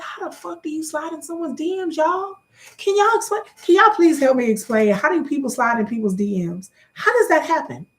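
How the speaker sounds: high-pitched, incredulous questioning; baffled disbelief. Real, not acted. Baffled, pleading for an explanation.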